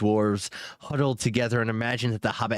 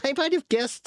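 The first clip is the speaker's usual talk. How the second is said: Bilbo voice. A narrator's reading. Bilbo flattering the dragon.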